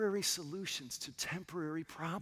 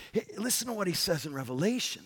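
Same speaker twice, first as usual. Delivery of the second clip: ordinary delivery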